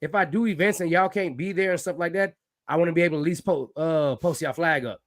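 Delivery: ordinary talk, nothing marked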